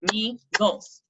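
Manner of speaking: "Measles" in two detached beats, MEA, SLES; clapped-out syllables. Shown as a demonstration. Teacher recapping two syllables for students, "Measles" broken in two. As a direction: break "Measles" between its two vowel sounds. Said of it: "two syllables".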